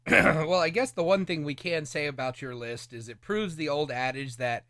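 usual speaking voice

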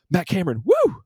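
high, loud holler from the crowd; excited high-pitched whoop at a drummer; loud concert yell. Retold or imitated. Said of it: "like, really loud".

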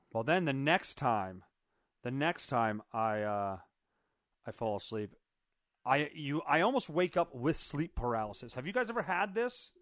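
ordinary talk, nothing marked

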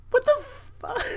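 high whispered outrage, near tears; hurt whisper. Real, not acted. Fan still hurt by the post-credit scene, whispering in outrage. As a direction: let the hurt break into a whisper. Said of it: "I'm mad".

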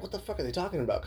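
high, soft, quick puzzlement; mock-baffled aside, hushed and rapid; retold bafflement. Host recalling first reading the emails, baffled.

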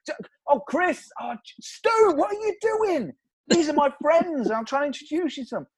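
panicked. Retold or imitated. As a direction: squeal in embarrassed panic. Host panicking at Stu.

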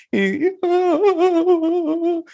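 put-on long falsetto wail of a child; imitated kid bawling, very high; drawn-out high squealing cry; mock crying wail. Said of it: "he's crying".

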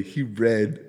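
normal talking voice

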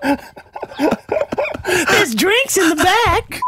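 very high, slow, sugary creepy voice; sweet creepy coaxing. Pretend. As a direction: lure them in like a creepy stranger. Creepy van kidnapper joke.

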